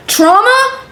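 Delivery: very high disbelieving "Trauma?"; mock incredulous squeak. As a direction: scoff at the excuse.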